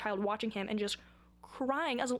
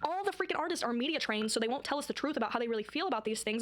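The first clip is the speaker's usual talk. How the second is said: ordinary delivery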